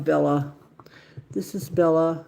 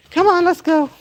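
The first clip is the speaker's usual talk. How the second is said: high dog call; 12 semitones higher, 11 dB louder than usual. Real, not acted. Calling the dog outside.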